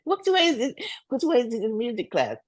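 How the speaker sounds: high, eager retold question; mock-eager child asking the way. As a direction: ask like a kid keen to get away. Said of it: "I said".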